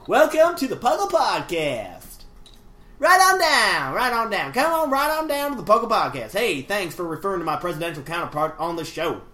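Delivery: hammed-up hillbilly accent, rousing welcome. Host reading Andrew Johnson's email to listeners, hillbilly accent. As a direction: put on a lively hillbilly showman's voice. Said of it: "part hillbilly".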